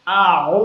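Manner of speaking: simple আ, then ও squeezed loudly from the throat; loud, constricted ayn on ও; pressure on second sound. Shown as a demonstration. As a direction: press hard on ও after আ. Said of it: "এক জায়গায় চাপ".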